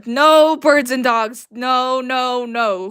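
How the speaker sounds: loud, slow string of refusals; loud protest. Real, not acted. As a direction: shout it down in disbelief. Reacting loudly to confusing chat message.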